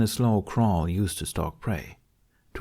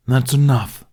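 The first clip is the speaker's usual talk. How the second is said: loud, sharp bark of an order; barked command; 4 semitones higher, 9 dB louder than usual. A narrator's reading. Toothless commanding the dragoness to halt, loud bark. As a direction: snap it out to stop her cold. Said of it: "he barked by way of command".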